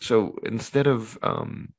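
soft, slow, hesitant; quiet. Real, not acted. Podcaster resuming an awkward premise, quiet and hesitant. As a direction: trail off under your voice. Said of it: "Why are you saying it quiet".

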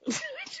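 high laugh. Real, not acted. Co-host laughs at bar joke.

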